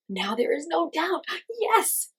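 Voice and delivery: high, rising joyful cry; exulting. A narrator's reading. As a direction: burst out in triumph. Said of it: "he exulted".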